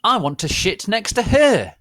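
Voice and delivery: high, loud petulant shout; angry shout. Acted. Jealous boy claiming the seat, high loud.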